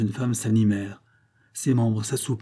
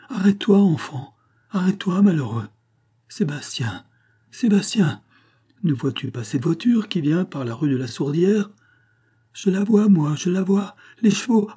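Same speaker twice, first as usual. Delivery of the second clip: urgent high pleas, building in alarm; panicked mother; 6 semitones higher than usual. Acted. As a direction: call out to stop a child in danger. Mother watches son run toward danger.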